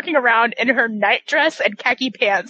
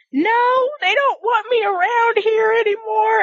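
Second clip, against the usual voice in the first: high, mock-pitiful protest; plaintive Alma; 10 semitones higher than usual. Teller voicing Alma feeling unwanted, plaintively. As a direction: imitate someone feeling hard done by, pitched well up.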